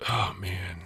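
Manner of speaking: breathy whispered aside. Real, not acted. Streamer muttering to himself, whispered. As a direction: sigh it out in a whisper.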